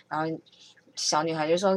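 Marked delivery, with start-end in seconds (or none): none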